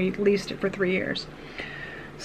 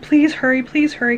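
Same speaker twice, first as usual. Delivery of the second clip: urgent retold plea, raised at first; begging; 4 semitones higher, 3 dB louder than usual. Mother on emergency call as car heats up, begging. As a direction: re-enact pleading for help to hurry. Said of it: "I'm begging with them".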